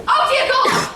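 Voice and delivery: high, loud mock horror; mock shocked. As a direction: gasp at the audience. Sees the audience under lights.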